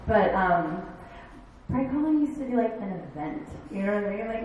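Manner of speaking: ordinary delivery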